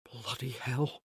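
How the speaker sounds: soft, slow muttered curse; cursed under breath. A narrator's reading. Care curses softly as another archer appears. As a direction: swear quietly to yourself. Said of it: "Care cursed under his breath".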